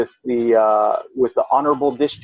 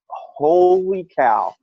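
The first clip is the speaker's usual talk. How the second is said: long drawn-out exclamation, pitched up; retold amazement; 5 semitones higher, much slower than usual. Reacting to news of the pregnancy, amazed. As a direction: stretch the first word in mock astonishment.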